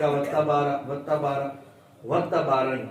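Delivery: وَتَبَارَ as "wattabara", وا fused to a doubled تا; common mistake. Shown as a demonstration. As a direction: repeat وَتَبَارَ with the first syllables joined. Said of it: "اکثر پڑھتے ہیں". (وَتَبَارَ، عام غلطی، واو تا ملا کر)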